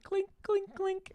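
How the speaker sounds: high little clinking sound. Retold or imitated. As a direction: tap out a tiny china knock. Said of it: "it makes a little, like".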